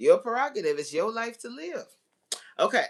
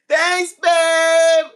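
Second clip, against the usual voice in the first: high, loud grateful call; loud thanks; 7 semitones higher, 15 dB louder than usual. Real, not acted. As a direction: call your thanks across the room. Thanking partner for gift.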